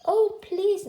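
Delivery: high, loud storybook plea; pleading. A narrator's reading. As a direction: beg the tiger with a big lift on please. Boy begs tiger for his life.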